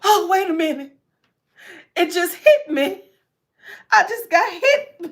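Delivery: high, loud excited gasp; laughing excited realization; sudden realization. Retold or imitated. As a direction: play the moment it hits her. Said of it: "She's like".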